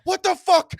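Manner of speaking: reenacted yell at his computer. Yelling at a coworker's email, reenacted furious shout. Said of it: "I started yelling at my computer".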